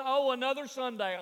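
words sinking into a long mock yawn; hammed-up weary boredom. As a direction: imitate a yawning churchgoer. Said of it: "somebody sitting behind him yawning".

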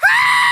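sudden very high, loud scream; piercing mock-panic shriek; demonstrated scream. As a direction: scream to confuse an attacker. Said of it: "I realized I can scream".

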